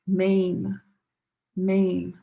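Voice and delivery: Maine as a single beat, "mayn"; one syllable. Shown as a demonstration. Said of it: "one syllable".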